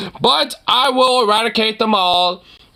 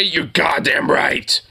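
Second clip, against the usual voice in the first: tougher version; 5 semitones lower, slower than usual. Shown as a demonstration. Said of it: "Why don't you just say".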